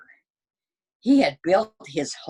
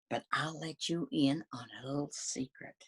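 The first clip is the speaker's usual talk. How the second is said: hushed secret; 10 dB quieter than usual. A narrator's reading. Narrator leaning in to share the wolf's secret, hushed. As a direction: let them in on a secret quietly. Wolf confides a secret to reader.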